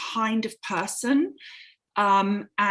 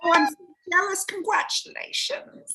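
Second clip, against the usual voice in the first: high, gushing coo of playful envy; gushing congratulations; 9 semitones higher than usual. Real, not acted. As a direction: swoon over the news of grandchildren. Guest reacts to host's grandsons.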